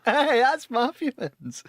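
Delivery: high, drawn-out cry of recognition; acted-out delighted shout; excited exclamation. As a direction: call it out high and slow, thrilled to spot it. Retold excited spotting of artwork.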